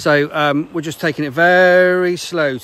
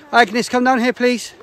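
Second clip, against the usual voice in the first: yelling across the room; 10 semitones higher, 5 dB louder, slower than usual. Real, not acted. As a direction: call her name across the woods. Calling child from distance.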